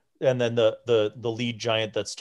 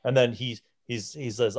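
ordinary delivery

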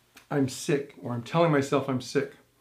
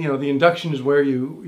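ordinary delivery

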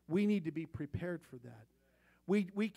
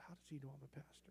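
muttered thought; 4 semitones lower, 20 dB quieter, faster than usual. Retold or imitated. Private thought, repeated twice.

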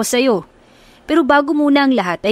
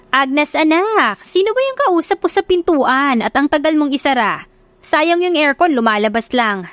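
a mom scolding about the aircon, voice pitched up; nagging mother; 6 semitones higher than usual. Acted. Mother calling to Agnes at the door, higher and nagging.

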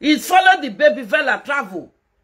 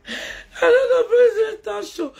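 high, drawn-out fake sob, much higher; mock wailing; 12 semitones higher, slower than usual. Teasing the crying people with a mock high wail. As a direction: wail it like a pretend crybaby, slowly.

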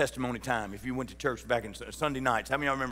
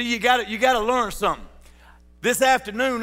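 full-force preaching; 9 semitones higher, 8 dB louder than usual. Acted. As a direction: preach it out to the rafters, drawn long.

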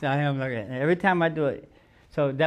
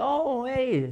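surprised greeting; 9 semitones higher than usual. Retold or imitated. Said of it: "I said".

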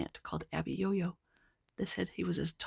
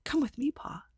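put-on boy whispering; 7 semitones higher, 3 dB quieter than usual. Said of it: "The boy says".

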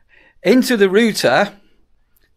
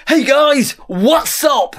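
mock YouTuber greeting; 9 semitones higher, slower than usual. Mocking British YouTubers copying Americans, high hammed-up greeting. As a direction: parody a vlogger opening with a wave. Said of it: "They start up their vlogs and go".

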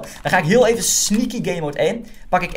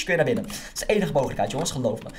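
normal talking voice

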